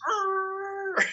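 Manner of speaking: high squealing tire screech; mock car screech. Telling friends about the egged car, high screeching noise. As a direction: imitate a car screeching to a stop. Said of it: "It just goes".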